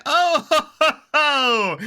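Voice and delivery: loud, high whooping "oh ho ho"; exclaimed "oh ho ho". Real, not acted. Reacting to the long recording, loud high "oh ho ho". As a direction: whoop it high, falling at the end.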